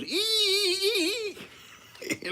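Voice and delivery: very high squeaky imitated crow; mock rooster crow. Man imitating his little rooster's crow, high squeak. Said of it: "He goes".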